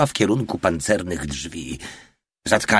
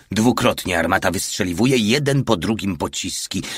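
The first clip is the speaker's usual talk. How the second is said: ordinary delivery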